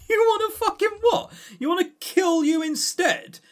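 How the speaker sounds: high, disbelieving outburst; shocked what-are-you-doing; incredulous. Real, not acted. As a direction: pitch it way up in disbelief. Incredulous outburst at game character.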